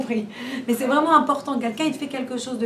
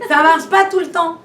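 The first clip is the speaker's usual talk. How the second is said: said through laughter, louder; laughing; 5 semitones higher, 8 dB louder than usual. Real, not acted. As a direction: laugh it out at her own misadventure. Laughs at her own story. (en riant franchement, plus fort)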